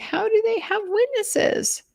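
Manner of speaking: Kathy's voice. Retold or imitated. Narrator voices the distraught wife asking about witnesses, high and slow. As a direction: ask slowly in a small high voice.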